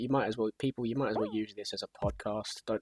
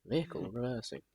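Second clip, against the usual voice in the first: softer echo of the alarm; truck reversing alarm; 5 dB quieter than usual. Retold or imitated. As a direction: repeat the machine warning quietly. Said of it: "They have that little au".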